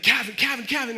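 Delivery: high, urgent repeated name-calling; urgent wake-up call. Retold or imitated. Roommate waking his friend in the night, urgent calling.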